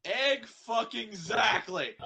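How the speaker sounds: loud, slow, high emphatic yell; emphatic shout. Acted. Friend yelling in mock agreement, loud and slow. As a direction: bellow it out, every word stretched.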